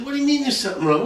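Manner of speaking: fast, high indignant question. Retold or imitated. Groom's indignant reply in joke.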